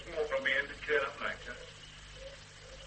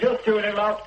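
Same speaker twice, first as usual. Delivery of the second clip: loud, raised reply; husband calling back to his wife, raised voice; 8 semitones higher, 8 dB louder than usual. Acted. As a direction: shout back to the next room.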